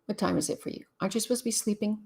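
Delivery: ordinary delivery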